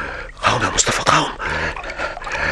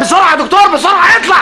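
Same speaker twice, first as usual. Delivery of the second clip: high, loud urgent yelling; frantic shout; 13 semitones higher, 4 dB louder than usual. Acted. Urging the doctor in the water to climb out, frantic shout. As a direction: scream at him to climb out.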